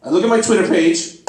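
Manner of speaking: ordinary talk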